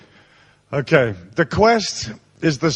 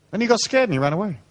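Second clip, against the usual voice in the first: normal talking voice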